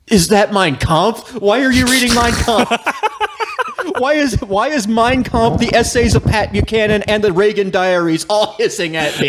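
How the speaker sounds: high-pitched mock horror at a discovery; alarmed disbelief. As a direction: voice climbing in comic dismay.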